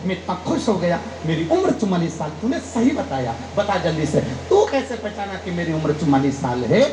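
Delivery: ordinary delivery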